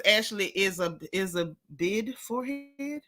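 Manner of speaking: slow, drawn-out mock of Candace. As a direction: put on a lightly voiced Candace impression. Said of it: "In my Candace voice".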